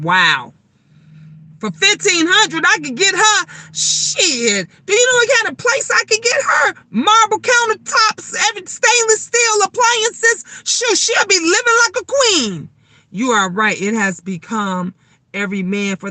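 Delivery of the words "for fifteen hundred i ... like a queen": outraged disbelief at the price, voice climbing; high indignant rant. Real, not acted. Venting to viewers about rent prices, high outraged rant.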